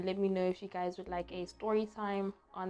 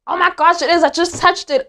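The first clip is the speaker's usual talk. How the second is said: loud high panicked yelling; screaming at a spider; 10 semitones higher, 17 dB louder than usual. Real, not acted. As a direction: scream in disgust at the bug. Touched the spider.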